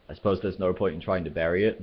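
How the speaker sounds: plain reading voice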